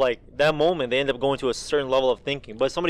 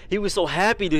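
usual speaking voice

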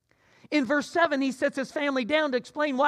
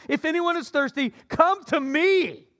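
loud cry; 6 semitones higher, 5 dB louder than usual. A narrator's reading. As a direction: call out the invitation across the crowd. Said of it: "cried out in a loud voice".

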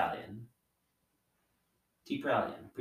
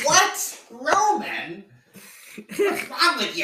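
mock outrage; 6 dB louder, slower than usual.

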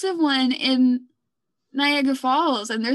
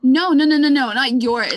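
loud insistent no; 8 dB louder than usual. Real, not acted. Reassuring co-host about her audio.

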